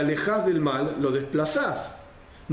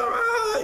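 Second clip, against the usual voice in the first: mock angry shout. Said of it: "el otro se pone a gritar".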